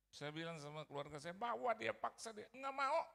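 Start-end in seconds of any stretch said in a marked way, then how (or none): 1.4-2.5 raised, higher-pitched urging; urgent order. Retold or imitated. Son urging his family about sick mother, urgently. 2.6-3.0 high, loud protest; exasperated refusal. Retold or imitated. Family answering the insistent son, exasperated.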